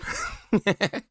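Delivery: bright high laugh; high giggle. Real, not acted. Laughs at popcorn face.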